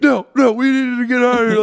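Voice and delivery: loud, high frantic imitation; mock panic. Older sister wanting out of the house, hammed-up panic. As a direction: babble it in alarm over the objection.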